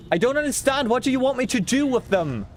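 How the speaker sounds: exasperated, high and bewildered; baffled outburst. Real, not acted. Baffled rant at chat.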